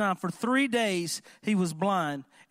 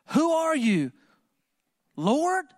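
higher and louder, alarmed question; startled cry; 7 semitones higher, 5 dB louder, slower than usual. Acted. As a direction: blurt it out in shock. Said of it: "Do you see the two differences".

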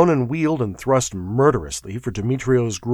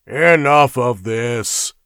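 slow, louder gruff warning; growled; 2 semitones higher, 6 dB louder, slower than usual. A narrator's reading. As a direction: lay it down slowly as a threat. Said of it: "growled Dionys".

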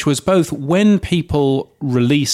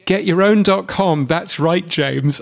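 Todd impression; 5 semitones higher than usual. James quoting Todd's sign-off line to Sam, mock host voice. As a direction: belt it like a host's tagline. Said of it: "I've got Todd saying".

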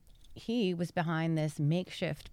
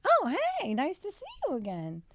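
a squeaky high greeting, much higher; mock eager hello; 13 semitones higher than usual. Spotting the bartender again, chirping a high hello.

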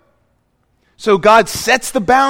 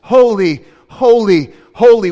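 building cry of holy, holy, holy; rising exclamation, each holy louder; a triple proclamation building to the last word; angels crying out; 2 semitones higher, 5 dB louder than usual. Retold or imitated. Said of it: "the angels all cry out".

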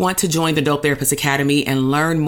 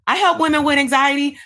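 "I help women with anxiety" said much higher as a mockery; mocking bad pitch; 9 semitones higher than usual. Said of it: "compared to".

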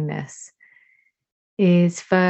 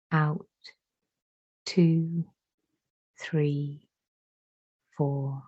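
slow breath count, softer on each count; 5 dB quieter, much slower than usual. Acted. Teacher counting breaths aloud for the class, soft guided count. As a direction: count their breaths in and out gently.

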